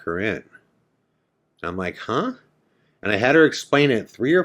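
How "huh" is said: high, sharp questioning huh; a puzzled, high-pitched huh; baffled "huh". Real, not acted. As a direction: react in disbelief. Hearing a strange claim.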